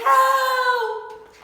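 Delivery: high, loud scared cry; frightened cry for help. A narrator's reading. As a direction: scream for help. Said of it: "the other one cried".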